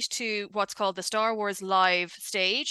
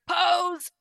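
imitated command to pose, pitched up; quick mock direction, higher voice; photo-call order; 9 semitones higher, 3 dB louder than usual. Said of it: "suddenly they're like".

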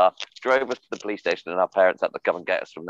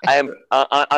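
ordinary delivery

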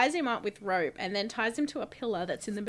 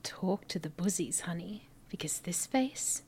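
breathy sultry whisper; 3 semitones lower, 5 dB quieter than usual. Retold or imitated. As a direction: whisper it seductively. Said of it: "sultry thing where she's like".

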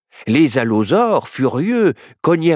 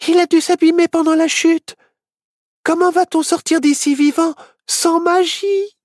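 very high, distressed wail; high-pitched helpless little witch; despairing; 3 dB louder than usual. Acted. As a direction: whine in dismay, drawing out the last words. Witch despairs without magic.

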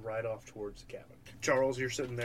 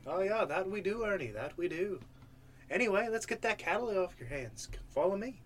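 higher-pitched, loose Irish lilt; attempted Irish accent; 7 semitones higher than usual. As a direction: greet them in a poorly held Irish accent. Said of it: "He's supposed to be Irish".

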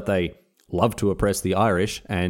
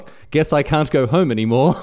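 mock wistful; 5 semitones higher, 5 dB louder than usual. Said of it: "these Welsh people being like".

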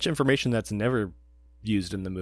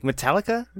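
guessing waitress; 9 semitones higher than usual. Retold or imitated. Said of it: "She's like".

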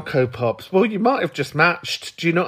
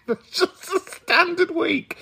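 higher, slower line said through laughter; laughing while joking; 14 semitones higher than usual. Real, not acted. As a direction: squeeze the words out between giggles. Laughing through Northern joke.

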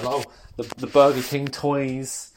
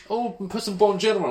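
higher, fussing mum voice; 8 semitones higher, faster than usual. Retold or imitated. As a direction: play the worried mum. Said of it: "Mum was all like".

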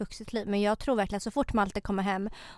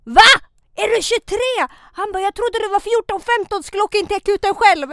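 put-on much higher, louder Finnish-accented disbelief; a hammed-up astonished Finnish cab driver; surprised Finnish driver; 10 semitones higher, 8 dB louder than usual. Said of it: "jag kan inte imitera mitt eget folk".

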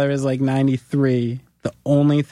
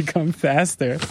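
ordinary delivery

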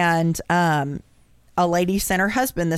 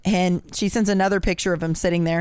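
ordinary delivery

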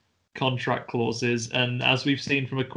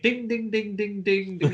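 high sing-song ding noises; high mock chiming; 8 semitones higher than usual. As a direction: chime like a little bell. Mimicking the boy's noises.

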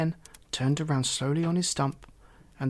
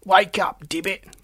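loud, high wake-up yell; calling to wake; 6 semitones higher, 7 dB louder than usual. A narrator's reading. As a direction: yell at a dozing friend, starting high. Toad yells at sleeper.

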